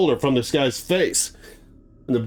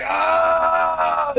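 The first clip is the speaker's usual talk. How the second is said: pained yell; 11 semitones higher than usual. Retold or imitated. Matthew falling back.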